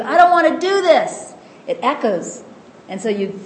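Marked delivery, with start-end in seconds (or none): 0.0-1.3 shouted high and loud, a mock yell into a cave; shouting into a cave. Teacher acting out the ego shouting resistance into a cave, loud mock yell. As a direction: yell it out like a stubborn protest. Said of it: "And when you shout in the cave".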